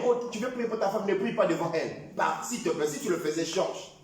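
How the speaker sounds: ordinary talk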